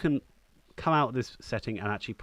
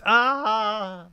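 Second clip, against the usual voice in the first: high, loud "aah" scream; mock death scream; 12 semitones higher, 7 dB louder than usual. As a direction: scream like a doomed extra dying. Acting British guy who dies.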